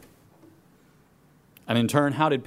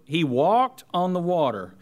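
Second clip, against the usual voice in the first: ordinary talk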